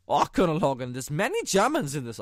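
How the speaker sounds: loud, hammed-up quote reading; mock German officer. Reading a German co-author's quote, hammed-up loud. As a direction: play it like a campy war-film German. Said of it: "I should read this in a German accent".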